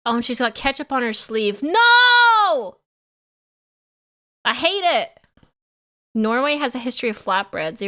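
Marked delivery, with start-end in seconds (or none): 1.6-2.8 long, high, loud wail of no; dismayed shout. Real, not acted. Streamer spotting ketchup on her sleeve, dismayed shout. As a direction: cry out in playful horror.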